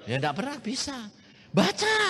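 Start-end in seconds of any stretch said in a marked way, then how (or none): 1.5-2.0 sharp high questioning teacher; teacher's question. Retold or imitated. Teacher in class demanding the pupil read, sharp and high.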